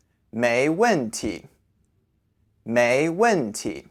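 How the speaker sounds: very slow, syllable by syllable; slow demonstration. Shown as a demonstration. Teacher giving the second way to say "you're welcome", slow demonstration. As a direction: stretch each syllable out for learners. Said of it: "the second way to say".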